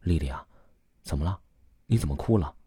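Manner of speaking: soft, low-pitched motherly concern; mild, hushed tenderness; gentle mother. A narrator's reading. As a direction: ask softly and tenderly, like a worried mother. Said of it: "传来母亲温和的声音".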